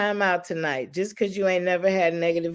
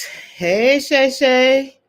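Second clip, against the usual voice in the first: higher and louder, bouncing the name; excited greeting; 7 semitones higher, 6 dB louder, much slower than usual. Real, not acted. Streamer greeting a regular in chat, excited call.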